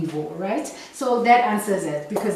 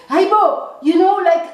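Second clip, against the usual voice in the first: incredulous exclamation; 10 semitones higher, 8 dB louder than usual. Retold or imitated. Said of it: "And I'm like".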